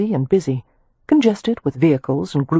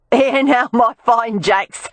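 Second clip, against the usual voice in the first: higher, slow Cockney woman calling out; a brassy Cockney street woman hailing the men; 7 semitones higher, 3 dB louder, slower than usual. Acted. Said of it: "called out in a Cockney accent".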